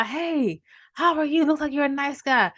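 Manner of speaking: high, bright greeting voice of a stranger; lightly voiced friendly passerby. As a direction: greet him like a cheerful stranger. Passerby warning the man.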